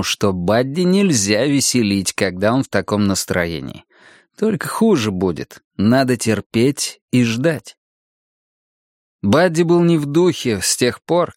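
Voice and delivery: ordinary delivery, nothing marked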